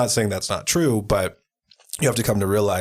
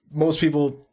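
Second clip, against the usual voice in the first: burping; 7 semitones higher, slower than usual. Real, not acted. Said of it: "excuse me, I'm, like, burping".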